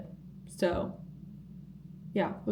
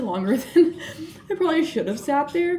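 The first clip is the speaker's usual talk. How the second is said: laughing through the words, pitch lifting; laughing admission; 7 semitones higher, 5 dB louder than usual. Real, not acted. Vlogger admitting her break ran long, laughing. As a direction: own up with a laugh.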